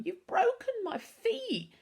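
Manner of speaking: high, slow wail of self-pity. A narrator's reading. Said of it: "Pickering wailed".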